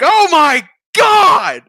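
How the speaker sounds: loud, high, slow "oh my God"; shocked exclamation. Retold or imitated. Principal seeing thrown kid, shocked. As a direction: stretch out the shock.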